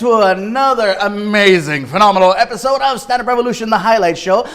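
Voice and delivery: loud, high announcer intro; hyped show host. Acted. Host welcoming viewers back, big announcer voice. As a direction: pump up the crowd like a TV host.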